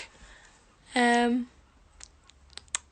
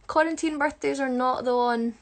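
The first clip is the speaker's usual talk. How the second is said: usual speaking voice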